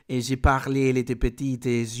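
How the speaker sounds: slow, hammed-up French accent; thick French accent. Shown as a demonstration. Streamer imitating a French speaker of English, thick French accent. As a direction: exaggerate a heavy French accent. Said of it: "when you have a thick French accent".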